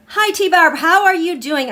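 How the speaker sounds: normal talking voice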